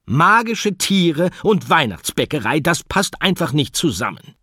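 high, loud fed-up scolding; the end of her patience; exasperated teacher. Acted. Teacher telling the animals off, loud exasperated.

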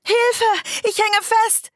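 very high, loud panicked cry; terrified scream. Acted. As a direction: scream she's stuck, panicking. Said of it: "schrie sie".

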